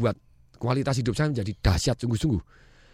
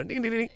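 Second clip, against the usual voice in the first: put-on kid walking noise; 10 semitones higher, 7 dB quieter than usual. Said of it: "Anak-anak itu kalau jalan kan lain".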